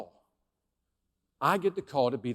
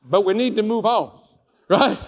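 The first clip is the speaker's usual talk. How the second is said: louder, insistent push to move on; firm push; 5 semitones higher, 9 dB louder than usual. Retold or imitated. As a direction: lean into it, press the point. Pushing the boss to move on.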